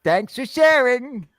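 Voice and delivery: high, slow, hurt snowflake voice. Shown as a demonstration. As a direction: say it like a wounded snowflake. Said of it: "read it in a snowflake voice".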